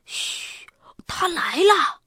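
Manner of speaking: hushed shush, then breathy high warning; 小声说. A narrator's reading. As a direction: whisper that someone's coming. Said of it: "巴斯特小声说". (先嘘一声，再小声提醒)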